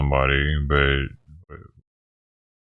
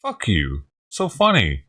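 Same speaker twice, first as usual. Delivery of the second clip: mock angry outburst; 7 semitones higher than usual. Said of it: "I'd be like".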